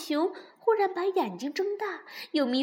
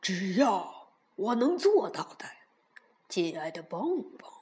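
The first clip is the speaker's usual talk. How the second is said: smiling bear; 6 semitones lower than usual. A narrator's reading. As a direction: kindly reassure them. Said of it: "大熊微笑着说".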